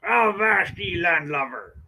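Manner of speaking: lightly voiced growly-free hammed pirate. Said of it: "that's not how pirates actually talk".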